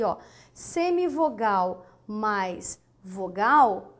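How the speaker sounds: ordinary talk, nothing marked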